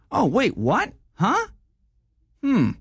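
puzzled, rising "what?" then a doubtful hum; confused double take. Pretend. Everyone checking their bank account, caught off guard and confused. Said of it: "Here's my impression of everyone checking their bank account".

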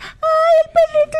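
loud, high-pitched cooing; gushing pet talk. Retold or imitated. Child mimics adult exciting the dog, gushing squeal. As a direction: squeal over the little dog. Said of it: "Pero si tú le dices".

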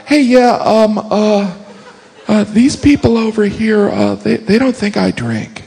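high-pitched, halting, hesitant heads-up; awkward prepping. Acted. Prepping friends before mixing two groups, awkward high voice. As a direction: stammer it out in a high, uneasy voice. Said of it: "feel like you have to prep 'em".

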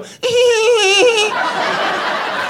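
high tittering giggle; a tiny creature's bashful giggle; doughboy giggle. Retold or imitated. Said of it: "he'd go".

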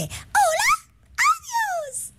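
very high, squeaky cartoon-girl voice on the phone; Burbuja. Shown as a demonstration. Host showing listeners her cartoon voice, very high and squeaky. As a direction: pitch way up into a tiny cartoon voice. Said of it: "puedo hacer la voz de Burbuja".

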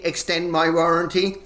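ordinary talk

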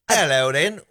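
posh British hello; a lightly put-on British greeting; British accent. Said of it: "in a British accent".

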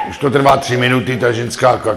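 normal talking voice

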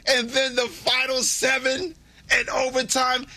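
high-pitched, sustained revival-style delivery; impassioned preaching. Acted. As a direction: proclaim it like a revelation, voice held high. Builds into mock sermon.

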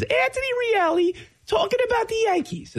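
very high, squeaky little announcer; squeaky kid voice. Retold or imitated. Host imitating his younger self calling games, squeaky voice. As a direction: squeak it out like a small boy playing broadcaster. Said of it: "I had a squeaky voice".

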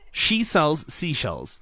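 slow, deliberate demonstration; picturing each word. Shown as a demonstration. Host coaches co-presenter through the tongue twister, slow and deliberate. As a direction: space out the words as if picturing the scene. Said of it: "envision what I'm actually saying".

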